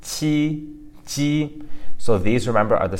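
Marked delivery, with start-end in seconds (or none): 0.0-0.8 qi high, even and held; smiling consonant demo. Shown as a demonstration. Teacher drilling smiling consonants, demonstrating qi. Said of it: "you're pronouncing". 1.0-1.6 ji high, even and held; smiling consonant demo. Shown as a demonstration. Teacher drilling smiling consonants, demonstrating ji. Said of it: "you're pronouncing".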